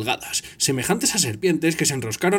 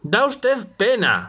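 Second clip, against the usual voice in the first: shouting; 6 semitones higher, much slower than usual. Acted. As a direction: shout it in disgust. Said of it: "gritó Harry".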